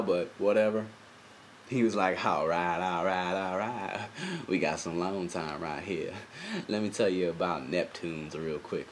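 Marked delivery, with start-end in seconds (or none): 2.3-8.9 lower, softer slow drawl; Matthew McConaughey impression. Cop creeping on Beth, in a low McConaughey drawl. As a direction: lay back and drawl it low. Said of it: "doing Matthew McConaughey".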